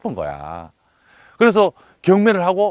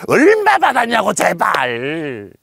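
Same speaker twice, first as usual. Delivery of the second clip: a pushy wife pressing her husband for the amount; demanding wife; 3 semitones higher, 4 dB louder, much slower than usual. Retold or imitated. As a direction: mock-desperate wife begging to know the price. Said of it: "이런 식으로 협박을".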